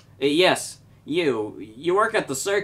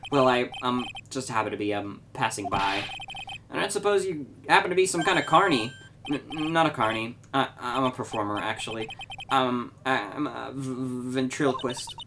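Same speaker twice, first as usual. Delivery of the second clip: softer, stammering nervous man; timid stammering ventriloquist; 3 dB quieter than usual. Acted. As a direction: trail off, stuttering out of nerves. Ben voiced timidly.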